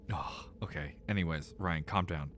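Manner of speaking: hushed self-calming whisper; a whispered pep talk to himself. Real, not acted. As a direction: breathe it out under your breath to calm down. Calming himself over frustrating controls.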